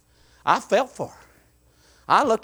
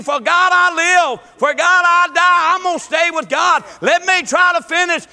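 loud, rising revival preaching; preaching at full force; 8 semitones higher, 5 dB louder than usual. Acted. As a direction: preach it hard to the congregation.

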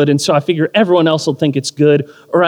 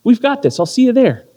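gentle reassurance; 7 semitones higher, faster than usual. Retold or imitated. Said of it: "Jill stepped up and said".

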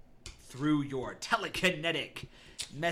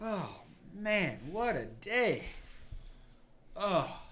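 put-on higher, swooping drunken sigh; drunk newcomer; 6 semitones higher, slower than usual. Drunk man walking into the bar, loose drunken voice. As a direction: groan it out like a merry drunk. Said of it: "drunk off his ass".

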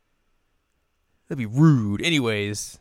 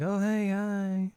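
much higher, slow, sheepish hi; awkward hello; 11 semitones higher, 4 dB quieter, slower than usual. Retold or imitated. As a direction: drag out an awkward greeting.

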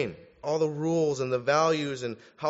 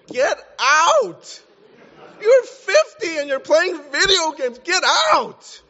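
full-voiced, high-pitched yell of a fed-up parent; exasperated parent shout; 12 semitones higher, 8 dB louder, slower than usual. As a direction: bellow it, throwing out your grown child. Parent evicting a grown child.

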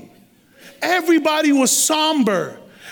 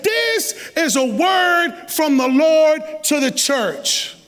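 declaration; 4 semitones higher, much slower than usual. Acted. Preacher declares the message.

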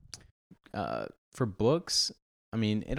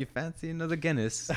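mock British accent; 7 semitones higher than usual. Joins the mock British bit.

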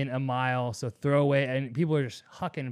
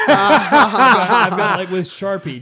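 laughing, high and loud; 5 semitones higher, 13 dB louder than usual. Real, not acted. Telling friends about the Sharpie prank, laughing. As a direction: break into laughter over the story.

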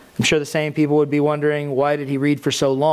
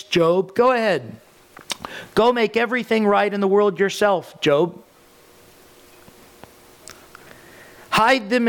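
God's challenge; 4 semitones higher than usual. Acted. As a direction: hurl the challenge like God mocking Job's pride. Voices God taunting Job.

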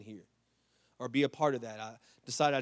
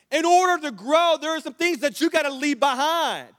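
full-force preaching; 13 semitones higher, 12 dB louder than usual. Acted. Pastor preaching about growth to the church, loud and high. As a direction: drive every line home to the church.

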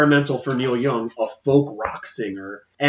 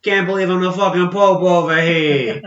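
mock Brooklyn tough guy, a bit louder; hammed-up Brooklyn wiseguy; Brooklyn asshole voice; 4 semitones higher, 6 dB louder than usual. Joking about the young Pope, mock Brooklyn wiseguy. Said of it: "like, an asshole from Brooklyn".

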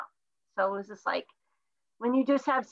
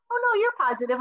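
chirpy clinic voice; 10 semitones higher, 5 dB louder, much faster than usual. Retold or imitated. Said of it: "And they're like".

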